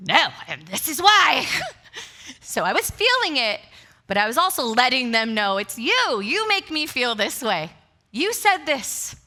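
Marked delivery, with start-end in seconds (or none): 0.1-1.5 louder, pointed comeback; mock irritated retort. [name] answering concerned colleagues with an irritated loud retort. Her snapping back at colleagues. 5.6-9.1 louder, higher, blaming tone; mock accusing. [name] blaming colleagues in the meeting, loud and accusing.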